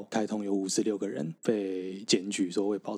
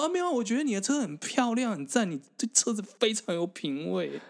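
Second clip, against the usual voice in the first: higher, syrupy fake compliments; butter him up with exaggerated sweetness; mock flattery; 11 semitones higher than usual. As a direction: pretend innocent praise to defuse an angry driver. Sarcastic flattery of the bad parker.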